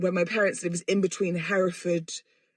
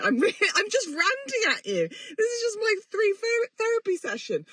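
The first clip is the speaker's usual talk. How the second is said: half laughing, half crying, pitched way up; laugh-cry as it all spills out; real tearful giggle; laughing through tears; 13 semitones higher than usual. Real, not acted. Laughing-crying while ranting about week.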